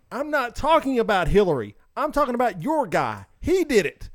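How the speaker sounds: rising, heated retort; retold argument. Arguing with a Trump-supporting coworker, getting heated. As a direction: push back harder with each line.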